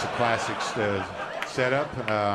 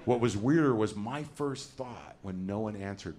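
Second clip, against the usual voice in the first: ordinary talk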